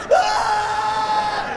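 a sudden high strained retch yell, loud. Retold or imitated. Comic telling the crowd about tequila vomit, high retching yell. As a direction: mimic projectile vomiting with a shriek.

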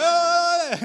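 high, loud exultant "Oh"; imitated preacher cry. Pastor imitating the worship leader whipping up the crowd, loud high cry. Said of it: "And he's like".

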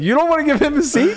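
high, laughing incredulous jab; mock the table for freezing him out, laughing; laughing mock outrage. Poker pro mocking the whales, high laughing jab. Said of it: "He'd be like".

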